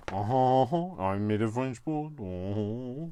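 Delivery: hammed-up bad French accent; jokey French accent showing off the board; terrible French accent. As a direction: put on a cheesy French voice. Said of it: "And terrible accent".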